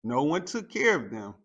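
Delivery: ordinary delivery, nothing marked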